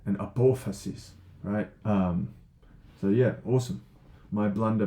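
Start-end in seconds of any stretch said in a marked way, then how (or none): 0.2-1.1 a-PO-phasis, stressed on PO; correct stress on apophasis. Shown as a demonstration. Teacher giving the right form, apophasis stressed on PO. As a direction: land on the second syllable of apophasis. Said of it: "way of stressing the word".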